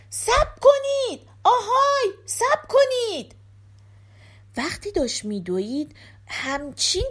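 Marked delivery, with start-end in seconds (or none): 0.1-3.3 high, drawn-out holler; shouting. A narrator's reading. Giske runs after the fleeing thieves, hollering for them. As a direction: shout after people running away. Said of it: "داد زد".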